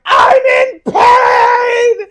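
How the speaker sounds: drawn-out, high, very loud mock agony; hammed-up tortured scream. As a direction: wail it slowly at the top of your voice. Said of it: "I'm screaming like".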